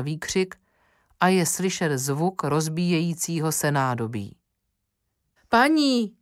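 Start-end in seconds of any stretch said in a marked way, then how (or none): 5.5-6.2 a loud, much higher cry up the stairs; shouting. A narrator's reading. Cook crying out to the lady from downstairs, loud shout. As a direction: cry out in alarm for the mistress. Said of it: "křičí kuchařka".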